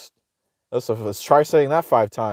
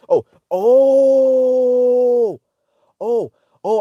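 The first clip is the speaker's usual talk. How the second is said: mock realization; 9 semitones higher, 5 dB louder, much slower than usual.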